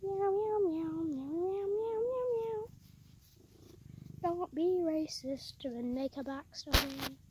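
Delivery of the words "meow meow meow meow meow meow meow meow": cat meow. Pretend. Meowing at the cat.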